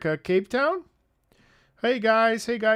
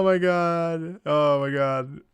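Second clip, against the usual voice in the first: ordinary talk, nothing marked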